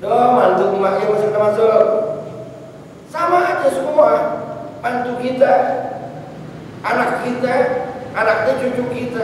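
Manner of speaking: high-pitched effusive in-law welcome; mother-in-law welcoming. Acted. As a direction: usher them in with gushing high voice. (mertua menyambut mantu, nada tinggi)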